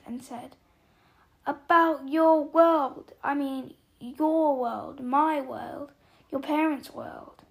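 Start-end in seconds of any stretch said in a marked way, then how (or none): 1.5-7.4 loud, stretched-out first words, then pressing on; exasperated emphasis. A narrator's reading. Giant presses the boy about the wizarding world, exasperated. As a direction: spell it out with forceful stresses.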